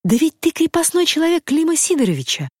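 high-pitched cry of recognition; startled heroine, voice shooting up; astonished exclamation. A narrator's reading. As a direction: exclaim in amazement, pitch leaping. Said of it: "вскричала Анюта с удивлением".

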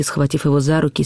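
ordinary delivery

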